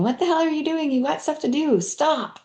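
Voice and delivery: high, impatient inner critic, not truly shouted; mock inner scolding. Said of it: "your brain already screaming at you".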